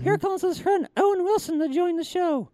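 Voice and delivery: high puppet voice; puppet announcer. Acted. Puppet brings in the actor's friend, high announcing voice. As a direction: pipe up to present the next guest. Puppet introduces next impression.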